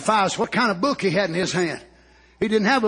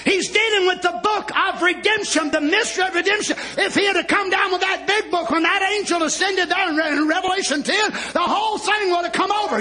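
high, driving exposition; full-force preaching; 10 semitones higher than usual. Real, not acted. Preacher explaining the seals to the congregation, fervent preaching. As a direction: press the point home at high pitch.

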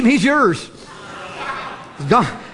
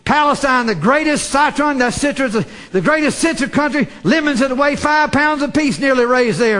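normal talking voice

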